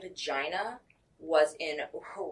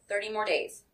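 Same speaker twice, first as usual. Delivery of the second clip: usual speaking voice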